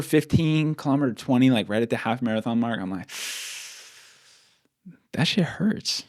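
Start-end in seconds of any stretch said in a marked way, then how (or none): 5.1-6.0 breathy, strained whisper; pained whisper. Retold or imitated. Runner retelling his calf pain mid-run, pained whisper. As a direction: whisper it through remembered pain.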